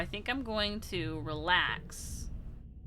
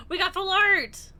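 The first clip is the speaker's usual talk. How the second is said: much higher and louder, thrilled; excited shout; 10 semitones higher, 10 dB louder than usual. Real, not acted. Streamer pulling a rare card, thrilled shout. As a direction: yell with delight at the rare pull.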